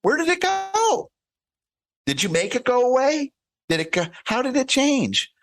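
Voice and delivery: curious high sing-song. Retold or imitated. Parent gently quizzing a calm kid about vanished anger, high and playful. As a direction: lift the voice way up, wondering aloud.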